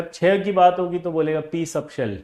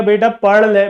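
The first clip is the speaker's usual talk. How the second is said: 1 father's call; 4 semitones higher, 10 dB louder, slower than usual. Retold or imitated. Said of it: "पिताजी ने कहा".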